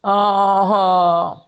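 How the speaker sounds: ordinary delivery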